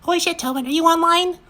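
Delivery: very high, squeaky mockery; foul-mouthed cartoon child, mocking. A narrator's reading. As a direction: mimic them in a squealing cartoon falsetto. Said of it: "Vanellope, mocking".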